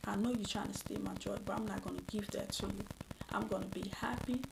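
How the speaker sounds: soft, resolved inner voice; calm self-talk. Shown as a demonstration. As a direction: talk yourself down quietly. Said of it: "just pause and say".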